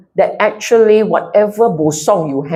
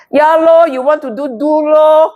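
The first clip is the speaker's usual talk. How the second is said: sulky Singlish dismissal; 8 semitones higher than usual. Retold or imitated. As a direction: imitate a passive-aggressive shrug in Singlish. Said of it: "You talk like that".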